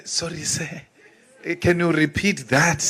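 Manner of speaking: soft, timid mock politeness; meek submissive apology. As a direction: play the meek servant, softly. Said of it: "they taught you that if you don't hear".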